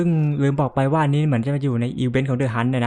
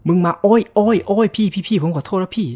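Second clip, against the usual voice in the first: higher, louder frantic begging; panicked pleading; 6 semitones higher, 4 dB louder than usual. Real, not acted. Player begs the monster to spare him, panicked.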